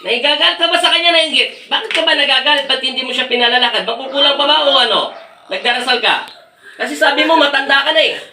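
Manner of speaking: high, insistent questioning; real exorcist's grilling; heated interrogation. Real, not acted. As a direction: confront the spirit, pitch pushed up.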